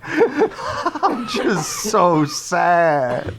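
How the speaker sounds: very slow, drooping, half-laughing sad voice; exaggerated mopey sadness. Retelling a sad stranger to a friend, slow mock-mopey voice. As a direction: drag it out like a deflated Charlie Brown. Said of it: "And he's doing, like, the whole arms down, like, sad".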